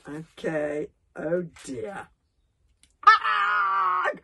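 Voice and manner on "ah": long, loud, high corpse cry; gurgling yell. A narrator's reading. Corpse crying straight into Gobber's face, gurgling. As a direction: let out a drawn-out gurgled scream. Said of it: "gurgled the corpse of Grimbeard".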